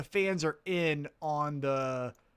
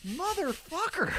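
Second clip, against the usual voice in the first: mock exasperation; 12 semitones higher than usual. Fan's exasperated reaction at meet-and-greet.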